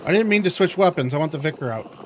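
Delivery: loud, high panicked outburst; yelling in real panic as the enemy closes in. Real, not acted. Enemy near, wrong weapon out.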